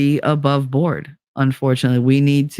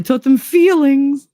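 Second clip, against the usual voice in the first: mocking; 10 semitones higher, 4 dB louder, slower than usual. Mocking the teaching of feelings.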